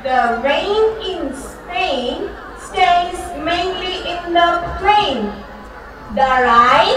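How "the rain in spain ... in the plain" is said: slow drill phrase. Shown as a demonstration. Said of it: "Say it again".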